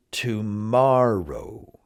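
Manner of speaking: "Tomorrow" slowly, MOR held long; stress on MOR. Shown as a demonstration. Teacher repeats the right emphasis, "Tomorrow" stressed on MOR. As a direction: lean hard on the middle syllable of "Tomorrow". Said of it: "the same with the next word".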